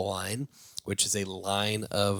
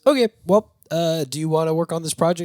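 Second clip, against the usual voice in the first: high, loud "okay, well", then quicker; retold businesslike woman in a raised voice; imitated brisk offer; 8 semitones higher, 6 dB louder, faster than usual. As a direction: play her moving briskly on, pitched up. Acts out the lady's offer.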